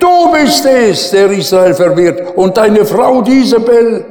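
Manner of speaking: forceful rebuke. Retold or imitated. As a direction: turn the accusation right back on the king. Prophet answers the king forcefully.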